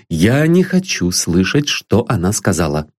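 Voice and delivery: usual speaking voice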